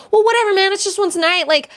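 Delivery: high-pitched imitation of a careless neighbor; airy mocking excuse.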